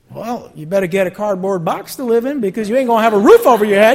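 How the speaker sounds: rising to a loud preaching scold. Real, not acted. Preacher warning the overspending congregation, loud scold.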